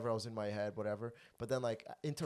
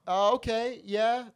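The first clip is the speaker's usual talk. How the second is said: high and loud awkward lady; lady's awkward reply; 11 semitones higher, 11 dB louder, much slower than usual. Retold or imitated. Sweet lady hearing a comedian's odd answer, high awkward okay. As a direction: play her stunned, polite and pitched way up. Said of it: "she just".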